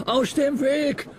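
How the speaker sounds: high slow desperate cry; desperate shout. Acted. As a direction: yell in panic to clear the track. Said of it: "brüllte er noch verzweifelt".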